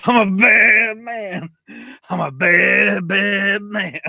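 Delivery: high, loud, slow mock tough-guy shout; hammed-up bad man. Mocking the wrestler's catchphrase with a hammed-up shout. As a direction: ham it up like a cartoon villain. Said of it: "And I'm going".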